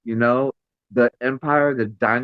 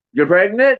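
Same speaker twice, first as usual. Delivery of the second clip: high, loud teasing question; playful loud gasp of a question; mock surprised guess; 8 semitones higher, 6 dB louder than usual. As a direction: blurt it out like a joke guess. Mock guess at sons' news.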